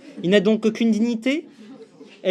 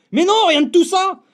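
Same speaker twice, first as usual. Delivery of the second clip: loud and higher, indignant; indignant outburst; 8 semitones higher, 5 dB louder than usual. Acted. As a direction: burst out in mock outrage.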